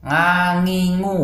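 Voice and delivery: ain turned into nasal nga; wrong nga. Shown as a demonstration. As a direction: say "Nga, ngi, ngu" through the nose. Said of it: "membaca dengan huruf nga". ("Nga, ngi, ngu" salah sengau)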